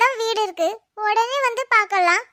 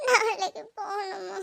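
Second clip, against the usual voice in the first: sobbing, drawn-out question; crying; 5 dB quieter, slower than usual. Acted. Child upset about having to go tomorrow, crying. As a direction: ask slowly through sobs, softer. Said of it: "அழுறியோ?".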